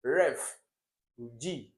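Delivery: REF barked out loud, pause, then a separate G; wrong stress on REF. Shown as a demonstration. Teacher showing why an option fails, exaggerated stress on ref. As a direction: punch ref, break, then tack on G. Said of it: "If you put it on this".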